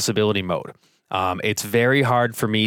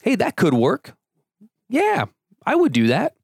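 hedging agreement; 10 semitones higher than usual. Shown as a demonstration. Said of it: "possibility words".

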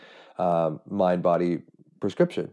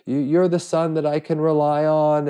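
higher, louder retelling of a mother's tearful plea; a touch of teary guilt-trip, pitched up and louder; mock tearful plea; 6 semitones higher, 4 dB louder than usual. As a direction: imitate a mother guilting her son through tears. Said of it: "getting upset and tearful".

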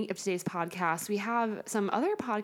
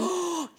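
excited gasp; 9 semitones higher than usual. Real, not acted. Excited gasp at ghost topic.

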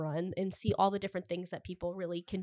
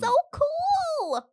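excited; 5 dB louder than usual. Real, not acted. Gushing about the art.

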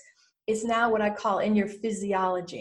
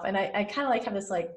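ordinary talk

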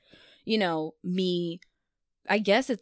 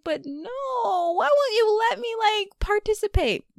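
high whiny protest; high childlike whine to God; whiny plea; 13 semitones higher than usual. Pretend. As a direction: plead like a kid. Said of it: "And I'm like".

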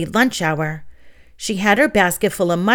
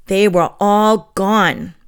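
very slow, drawn-out reveal; dramatic slow reveal; 4 dB louder, much slower than usual. A narrator's reading. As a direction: let it land slowly, louder.

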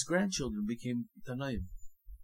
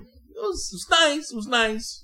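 much higher and louder, mock cheerful; sarcastic nice; 11 dB louder than usual. Preacher mocking the Egypt years as nice, high and loud sarcasm. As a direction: gush sarcastically about slavery.

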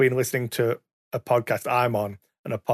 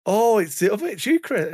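surprised caller; 9 semitones higher, 3 dB louder than usual. Retold or imitated. Caller surprised recognizing him.

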